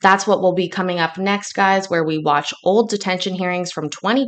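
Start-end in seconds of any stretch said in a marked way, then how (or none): none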